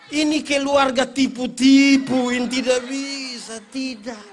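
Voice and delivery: loud, slow, laughing protest; indignant child. Retold or imitated. Child protesting the family's trick, loud slow laughing complaint. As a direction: call out the trick like a sulky kid.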